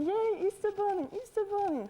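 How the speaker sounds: children's gleeful cry, imitated; imitating excited children. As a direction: squeal it like delighted kids, at half volume. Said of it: "they're screaming".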